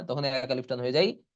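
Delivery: ordinary talk, nothing marked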